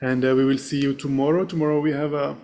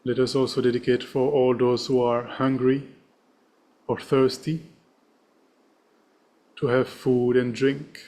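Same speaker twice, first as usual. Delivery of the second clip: serene. Acted. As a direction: read the dedication gently with long pauses. Leads a dedication prayer.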